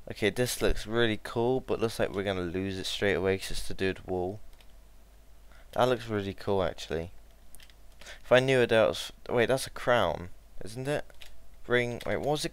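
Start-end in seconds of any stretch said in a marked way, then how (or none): none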